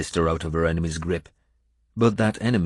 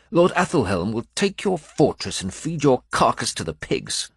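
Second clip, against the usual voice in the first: defiant prisoner; 6 semitones higher than usual. Acted. Herewulf threatens Uhtred.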